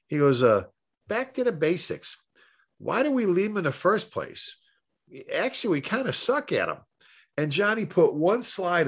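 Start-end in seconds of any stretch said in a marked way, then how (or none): 0.4-6.8 put-on Boston accent. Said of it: "I can't even do a Boston accent".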